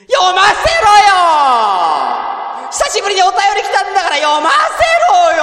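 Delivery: high-pitched, drawn-out yelling complaint; mock indignant wail. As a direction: wail your protest in a theatrical tantrum. Mock tantrum over unreadable letter.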